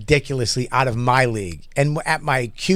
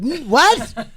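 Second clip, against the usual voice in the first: high stunned what; hammed-up disbelief; mock shock; 11 semitones higher than usual. Hearing of Louis's divorce, mock shock. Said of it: "I was like".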